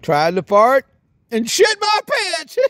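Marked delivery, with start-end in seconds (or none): 1.3-2.6 punchline shout. Acted.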